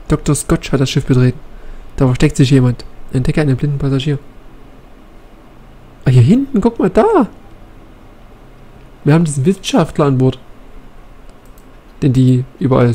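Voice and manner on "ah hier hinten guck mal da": excited discovery. Real, not acted. Spots a hidden stowaway.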